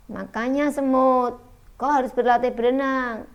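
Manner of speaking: mild acted exhortation; lightly voiced frog. A narrator's reading. Fable frog urges the ant.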